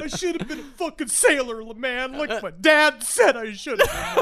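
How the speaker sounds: high-pitched hammed-up lament; regretful stoner. Stoner rethinking his life, in high comic despair. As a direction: wail it high, like a burnout full of regret.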